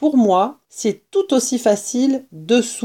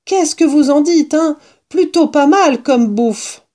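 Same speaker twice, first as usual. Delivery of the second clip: higher, louder proud fox showing off; boastful fox; 6 semitones higher, 4 dB louder than usual. A narrator's reading. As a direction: crow about the food like a pleased fox. Mr Fox boasting to his guests.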